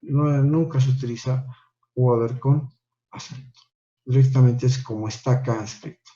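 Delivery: ordinary talk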